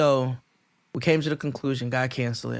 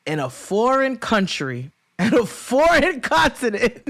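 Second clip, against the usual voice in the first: building emphasis; 7 semitones higher than usual. Real, not acted. Building list of foreign.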